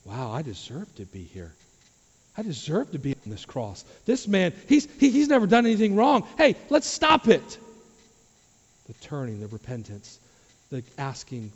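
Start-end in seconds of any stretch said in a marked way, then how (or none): 0.0-3.8 soft, awed admission of guilt; humbled realization. Acted. Acts thief's dawning repentance. 4.0-7.6 high, loud, agitated defence; urgent protest. Acted. Acts thief defending innocent Jesus.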